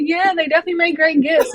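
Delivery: replaying her own reaction with a giggle; laughing agreement. Host quoting her own agreement.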